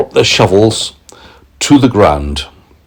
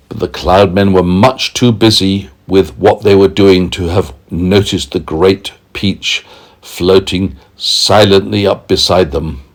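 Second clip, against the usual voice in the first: plain reading voice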